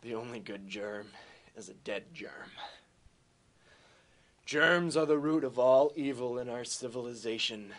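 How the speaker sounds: a hint of a low action hero growl; low tough-guy voice. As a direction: play it like a grim action hero. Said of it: "And he says".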